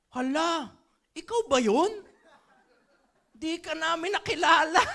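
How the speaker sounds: high, loud, laughing through the words; cracking up at not recognising her; laughing surprise. Retold or imitated. Saint Peter fails to recognise her.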